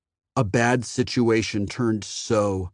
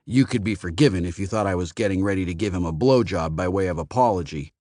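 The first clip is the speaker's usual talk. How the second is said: usual speaking voice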